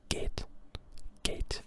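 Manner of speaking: "Gate" with a pure long E instead of the English glide; German geht. Shown as a demonstration. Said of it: "instead of the A, you say E".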